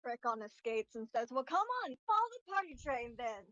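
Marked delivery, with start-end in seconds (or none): 1.3-3.4 higher, upbeat beckoning call; rallying party leader. Acted. Ajax leading children after a skate trick, upbeat rallying. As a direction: call the kids along cheerily, pitched up.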